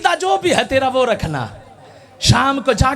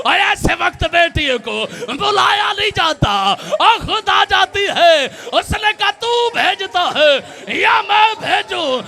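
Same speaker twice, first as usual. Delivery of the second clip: impassioned preaching; 8 semitones higher than usual. Acted. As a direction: hurl the scene at the mourners. Climactic confrontation scene. (ऊँची आवाज़ में धीमा, ज़ोरदार मातमी बयान)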